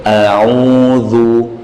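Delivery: A'udzu with the tongue back to rest before dzu; careful A'udzu demo. Shown as a demonstration. Teacher to tajwid students, careful A'udzu demo. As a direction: glide A'udzu without pushing the lips. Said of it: "Jadi bukan langsung dimonyongkan".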